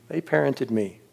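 hushed repeat of the words; soft echo. Retold or imitated. To the listeners, echoing the phrase softly. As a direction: let it sink in, quietly.

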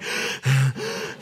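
small panting gasp; imitated dog gasp. Pastor imitating his hurt dog's panting. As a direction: pant like a shaken little dog. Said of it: "Bear is going".

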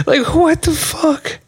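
very high, slow squeal of disbelief; incredulous. Real, not acted. Laughing at the book title, high-pitched disbelief. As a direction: squeak it out slowly, amused and baffled.

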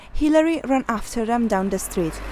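plain reading voice

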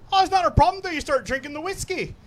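put-on Irish-sounding accent. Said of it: "when the guy became Irish".

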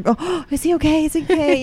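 high, gasping mock panic; mock worried gasp. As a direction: fret over the baby, gasp.

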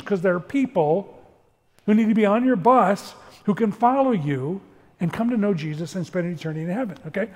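ordinary talk

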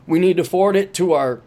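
ordinary talk, nothing marked

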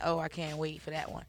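usual speaking voice

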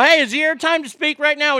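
loud high scolding call-out; pretend yelling at interrupter. As the congressman silencing a chirping colleague, loud. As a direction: bark it at the heckler. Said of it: "I go".

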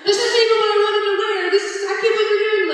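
high, loud, fast shocked squeal; shocked disbelief. Retold or imitated. Woman stunned as boyfriend kneels, high shocked squeal.

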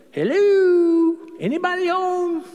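high, knocking call; Jesus calling from inside, hoping someone answers. Pretend.

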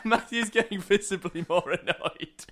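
laughing through the words, slowed; giggly teasing report; laughing tease. Real, not acted. Podcaster laughing at his co-host's annoyance, teasing.

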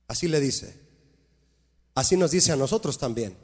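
ordinary talk

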